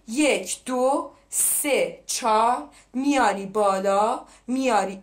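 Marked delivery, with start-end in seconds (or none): 0.1-2.7 drawn-out teacher's count for the class; slow counted demo. Shown as a demonstration. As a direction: count it out very slowly, beat by beat. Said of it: "ببین".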